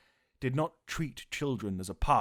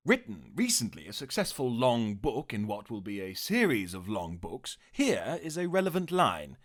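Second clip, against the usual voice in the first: normal talking voice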